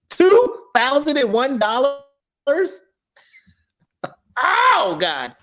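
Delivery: high, incredulous outburst; shocked at the price, voice shooting up; outraged disbelief. Real, not acted. Ranting about airline prices.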